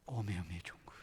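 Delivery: put-on light Mokpo accent, understated; Mokpo dialect. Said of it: "오메오메 종그 그러던가요?".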